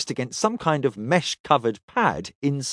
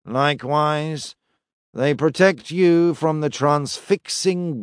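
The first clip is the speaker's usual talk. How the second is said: slow, even, droning priest; flat monotone voice. Acted. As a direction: intone it without any feeling. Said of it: "intoned in a flat, vaguely nasal voice".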